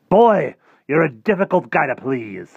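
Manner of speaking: high, loud, theatrical complaint; loud exasperation. Acted. Rictus failing to please Harvey, exasperated. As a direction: throw up your hands vocally, big and loud. Tempter frustrated with boy.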